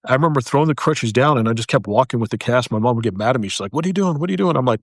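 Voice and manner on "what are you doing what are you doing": higher, alarmed scolding mother; worried mom. Retold or imitated. Mom angry about walking.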